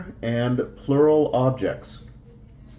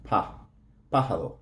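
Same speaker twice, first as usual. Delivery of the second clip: "pájaro" stressed on the first syllable, PA; stress on accented A; 4 semitones lower, 6 dB quieter, faster than usual. Shown as a demonstration. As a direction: lean on the "Pa" that opens "pájaro". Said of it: "stress is going to be on the A with the accent".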